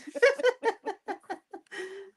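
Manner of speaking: high giggle at yourself; amused, high laugh; real laughter. Real, not acted. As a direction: burst into high-pitched giggles. Laughs about slipping into Scouse.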